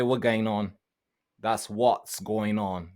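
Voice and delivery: normal talking voice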